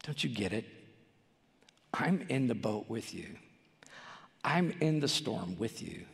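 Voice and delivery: calm a frightened friend; gentle Jesus. Retold or imitated. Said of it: "he looks at his disciples and he says".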